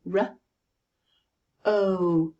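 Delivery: R, then a long o as in no; short R, pause, stretched o; R... o, one sound at a time; sounds in isolation. Shown as a demonstration. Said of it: "look at the sounds in that word".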